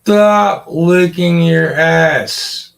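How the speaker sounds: loud, high, slow scold at the dog. Real, not acted. Owner scolds the dog for licking itself, loud and slow. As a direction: bark it slowly at the dog to stop.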